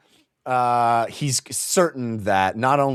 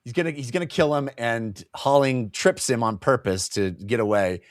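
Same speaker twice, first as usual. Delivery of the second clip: ordinary talk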